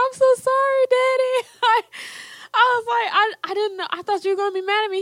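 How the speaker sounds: high, teary voice; half-laughing through tears; tearful apology. Retold or imitated. Daughter apologizing to Dad, tearful. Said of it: "Cried again".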